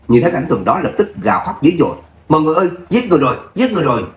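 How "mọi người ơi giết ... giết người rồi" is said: fast, high mock-hysterical cry; piercing wailing shriek. A narrator's reading. Mother-in-law wailing murder to the neighbourhood, high and rushed. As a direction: rush it out like a panicked alarm. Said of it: "Giọng bà ta cực kỳ bén nhọn".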